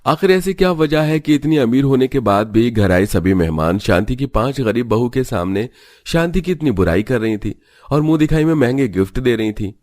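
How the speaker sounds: usual speaking voice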